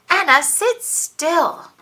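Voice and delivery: high, loud, slow command; stern mom. Acted. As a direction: make her sit still. Hurried mom scolding daughter.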